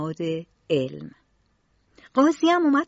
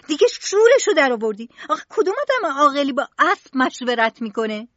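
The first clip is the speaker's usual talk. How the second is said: louder, higher, fast exasperated yell; loud outburst; 6 semitones higher, 5 dB louder, faster than usual. Acted. Mother loses her temper at her son talking to a horse, fast exasperated yell. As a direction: blow up at the boy in disbelief. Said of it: "با صدای بلند گفت".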